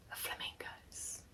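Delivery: slow, hushed, delighted whisper; surprised whisper. Real, not acted. Vlogger discovering flamingos on the fabric, whispering. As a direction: breathe the word out in delight.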